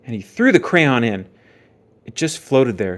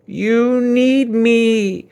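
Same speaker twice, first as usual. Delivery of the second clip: very slow, high, loud eerie voice; creepy crayon voice; 10 semitones higher, 6 dB louder, much slower than usual. Acted. The crayon's mirror message to Jasper, slow and eerie. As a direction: draw it out like a haunting.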